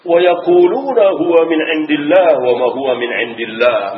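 "Wa yaquluna huwa min indillah" intoned loud and high in Arabic; recited verse. Real, not acted. Preacher reciting the verse to the congregation, intoned. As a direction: chant the verse with full voice.

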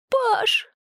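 very high, thin cry for help; terrified call. A narrator's reading. Girl calling her friend as creatures crawl closer, terrified call. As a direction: cry his name in fright.

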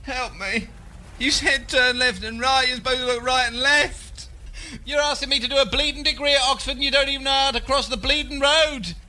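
panicked injured shout. Acted. Hit crossing the road.

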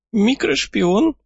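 put-on high, slow sly old codger; a crafty old man pleased with himself. A narrator's reading.

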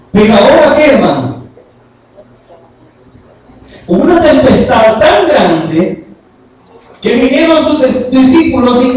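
loud, high, rousing sermon voice; fervent preaching. Acted. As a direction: preach it out to the congregation. Climax of the storm sermon.

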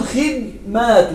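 خدمات said khidmāt, no vowel after the d; plural with sukun. Shown as a demonstration. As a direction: say خدمات as khid-māt, the d closed. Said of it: "ومع التسكين نقول". (خدمات بتسكين الدال: خِدْمات)

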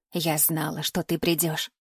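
put-on low, soft old woman; knowing old woman. A narrator's reading. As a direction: speak low and quietly, as an old seer. Old woman's eerie greeting. (негромкий низкий голос старухи)

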